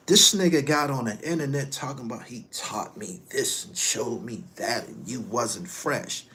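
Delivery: normal talking voice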